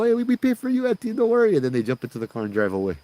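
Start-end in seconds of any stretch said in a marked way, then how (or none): 0.0-1.6 very high, louder stammered promise; mock-panicked, over-eager reassurance; frantic reassurance. Boys giving auntie all their money, high and frantic.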